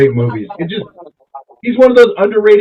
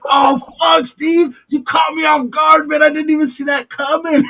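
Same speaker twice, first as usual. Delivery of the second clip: mock astonishment; 11 semitones higher than usual. Shot man to Steve, hammed-up surprise. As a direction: play caught off guard, voice way up. Shot victim's reaction in retold scene.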